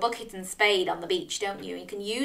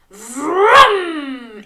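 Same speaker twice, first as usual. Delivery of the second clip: loud, long engine rev; imitated engine vroom; 6 semitones higher, 10 dB louder than usual. Flamingo's monster truck arrives, loud vroom.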